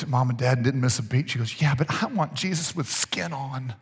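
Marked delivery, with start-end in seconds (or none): 1.6-3.7 put-on slow, softer child's voice with the punchline; soft little girl. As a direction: say it small and slow, like a sleepy child.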